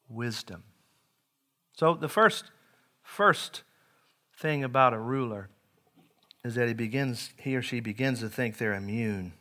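ordinary delivery

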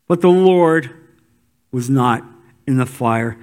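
ordinary talk

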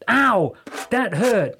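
high, loud yelp of pain; pained exclamation. Acted. Archie yelps as Jessica pushes him, pained exclamation. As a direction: yelp it as if just jabbed. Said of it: "he exclaimed".

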